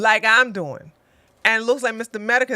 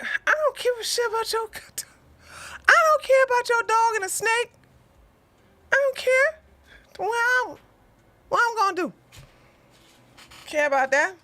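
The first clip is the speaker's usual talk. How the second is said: much higher, fast dismissive put-on voice; mock regular accent; faster than usual. Mimicking someone in a regular accent telling the storyteller off, high and fast. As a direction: brush them off in a squeaky higher voice. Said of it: "somebody in a regular accent".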